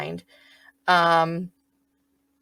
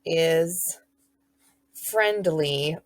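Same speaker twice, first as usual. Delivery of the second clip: slow dictation; much slower than usual. Shown as a demonstration. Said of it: "and then".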